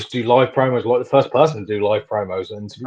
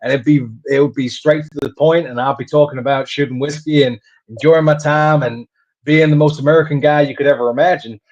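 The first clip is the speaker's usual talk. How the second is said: put-on all-American character voice; American accent; 2 semitones higher than usual. Shown as a demonstration. Wrestler demonstrating his old whiskey-loving character, American accent. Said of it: "I'd come out and talk like this".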